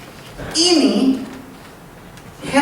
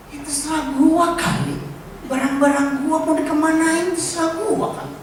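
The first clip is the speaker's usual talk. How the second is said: dismissive shrug; 7 semitones higher than usual. Acted. Preacher voices dismissive Pak Purba.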